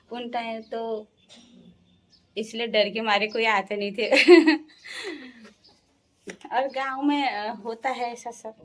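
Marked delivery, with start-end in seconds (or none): 4.1-4.6 loud, short laugh; amused laugh. Real, not acted. Nobody came for fear of father-in-law, amused laugh. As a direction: burst out in a quick laugh.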